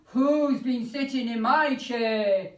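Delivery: slow, loud bear growl; Daddy Bear growling. Acted. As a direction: growl it like a big angry father bear. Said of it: "he growled".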